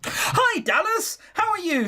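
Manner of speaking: very high-pitched, put-on friendliness; over-cheery greeting. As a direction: greet an ex in a squeaky-high, overdone hello. Acted awkward greeting to ex.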